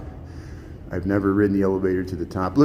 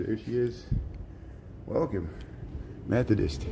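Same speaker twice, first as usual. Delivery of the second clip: hushed golf-announcer murmur; quiet aside like at a golf green; golf commentator; 10 dB quieter than usual. Real, not acted. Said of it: "I try to use my golf voice there".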